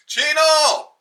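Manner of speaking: high, loud, drawn-out holler; calling out. Acted. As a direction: shout the nickname down the street. Calling out to the policeman.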